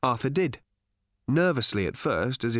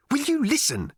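exasperated snap, much higher pitch; snapped; 3 dB louder than usual. A narrator's reading. Ford angry at Arthur's confusion, snapping. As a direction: demand attention impatiently. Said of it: "he snapped".

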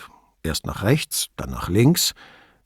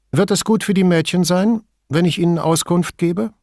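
higher, concerned question; worried; 8 semitones higher than usual. A narrator's reading. Signora Toso asking police about the girls, worried. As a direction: ask with a touch of worry, pitched up. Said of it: "fragte sie besorgt".

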